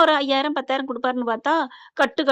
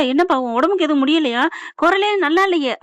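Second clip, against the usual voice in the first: raised, louder worried questioning; retold alarm; 4 semitones higher, 6 dB louder than usual. Father asking daughter if she is unwell, acted alarm. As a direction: imitate a parent getting flustered with concern. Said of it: "அப்படின்னு பதறாங்க".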